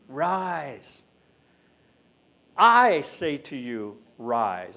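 slow, solemn command. Acted. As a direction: draw out each word with authority. Preacher prefers "rise", voices Jesus's command.

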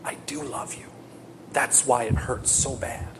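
very soft, intimate reassurance; acted whisper. God answering the one who cries out in hurt, acted whisper. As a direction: drop to a hushed, tender near-whisper. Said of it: "He whispers".

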